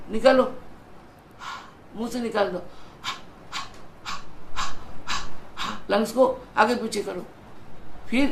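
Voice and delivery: counting out each breath like a yoga teacher; steady instructor's count for pranayama; guided breathing count. Acted. Leads a breathing exercise. (प्राणायाम में साँस की गिनती कराते हुए)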